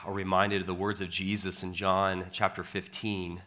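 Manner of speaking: soft, hushed pastoral prayer. Acted. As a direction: pray quietly before the sermon. Opens with a prayer.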